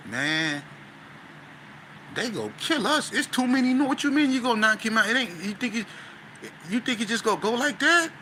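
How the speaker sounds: high, rushed, alarmed pleading; retold panicked protest. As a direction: replay the panic of being outnumbered.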